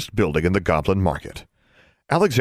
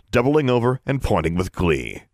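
plain reading voice

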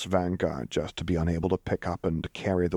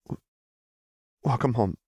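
choked up; 5 semitones higher than usual. A narrator's reading. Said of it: "Lux swallowed thickly".

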